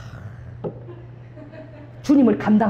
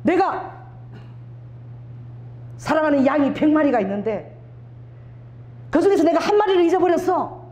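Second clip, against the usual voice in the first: higher, solemn divine voice; voice of the Lord; 6 semitones higher than usual. Retold or imitated. Preacher voicing the Lord's words, higher and solemn. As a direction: speak as the Lord calling for his lost sheep. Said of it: "주님이 말씀하시는 거예요".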